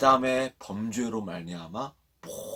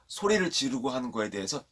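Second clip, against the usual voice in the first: ordinary talk, nothing marked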